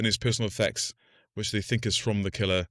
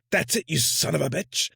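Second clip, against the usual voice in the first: higher, hammed-up detective outburst; mock Will Graham eureka; 8 semitones higher than usual. Film detective talking to himself, mock eureka. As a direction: blurt it like a cop who just cracked it. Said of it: "And he's like".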